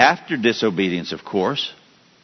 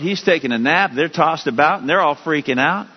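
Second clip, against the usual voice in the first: ordinary delivery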